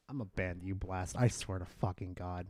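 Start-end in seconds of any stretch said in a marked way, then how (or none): none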